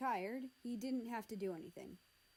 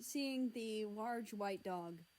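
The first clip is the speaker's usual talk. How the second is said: ordinary delivery, nothing marked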